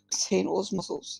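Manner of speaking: ordinary delivery, nothing marked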